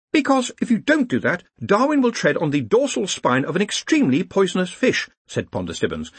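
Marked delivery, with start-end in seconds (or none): 0.1-5.1 high, insistent explanation; high-pitched academic explaining; earnest young organiser. Acted. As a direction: explain urgently in a raised pitch. Ponder explains the task.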